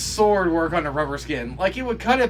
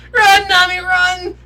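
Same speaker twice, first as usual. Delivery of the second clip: much higher, loud cheer; urgent yell; 12 dB louder, slower than usual. Real, not acted. Viewer cheering Nami to flee, urgent yell. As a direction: urge her on at the top of your voice.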